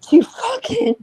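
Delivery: real voice breaking mid-rant; choked-up high crack; fighting back tears on the curse. Real, not acted.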